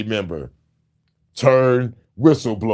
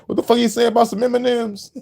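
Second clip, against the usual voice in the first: baffled outburst; 9 semitones higher than usual. Retold or imitated. Mocking baffled reaction to lyrics.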